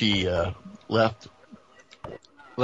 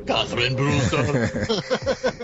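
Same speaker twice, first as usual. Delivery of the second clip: laughing; 4 semitones higher, much slower than usual. Real, not acted. Laughing at the name correction.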